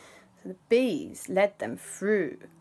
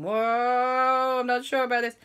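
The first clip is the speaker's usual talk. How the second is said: nervous bees; 5 semitones higher than usual. Acted. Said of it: "All the bees were buzzing around going".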